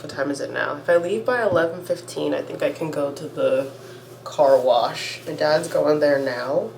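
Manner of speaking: normal talking voice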